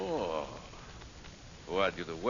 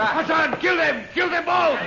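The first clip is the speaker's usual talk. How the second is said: loud, higher kill order; furious shout; 10 semitones higher, 4 dB louder than usual. Acted. Villain orders his men to kill both, furious shout. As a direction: roar the order across the room.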